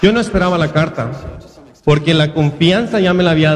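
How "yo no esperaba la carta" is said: ordinary delivery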